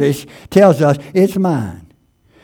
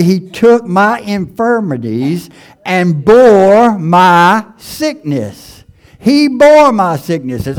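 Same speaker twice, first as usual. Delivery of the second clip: rising, emphatic sermon delivery; preaching; 5 semitones higher than usual. Acted. Preacher rousing the congregation about healing, building emphasis. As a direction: lift the congregation with each line.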